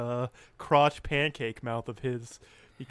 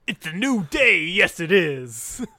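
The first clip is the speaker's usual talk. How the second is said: entrance catchphrase shout; 3 semitones higher, 7 dB louder than usual. Acted. Podcaster imitating the New Day entrance, catchphrase shout. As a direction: belt it out like a wrestling entrance, slowing at the end.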